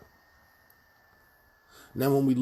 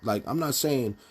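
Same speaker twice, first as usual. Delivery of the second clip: ordinary delivery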